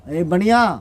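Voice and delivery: loud commanding call to the merchant; lion's challenge. Acted. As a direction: bark it out like a lion standing tall. Lion stands tall, challenges merchant. (शेर की ऊँची ललकार)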